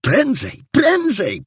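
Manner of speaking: high, pressing plea to hurry; anxious urging. A narrator's reading. Worried onlookers urge the captain to hurry, anxious. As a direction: urge them on, pitch climbing very high. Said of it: "Sierżant Antczak niepokoił się".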